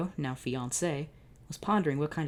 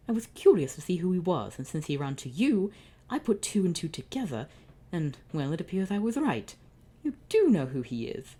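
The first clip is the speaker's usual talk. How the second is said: higher, louder sneering taunt; smug villain brother; 4 semitones higher, 4 dB louder than usual. Acted. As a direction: lord it over him like a gloating sibling.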